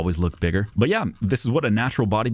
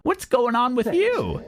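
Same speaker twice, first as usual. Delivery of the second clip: high, drawn-out comic outrage; indignant; slower than usual. Acted. Storyteller ranting at the rude cashier, indignant. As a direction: pitch it way up in disbelief.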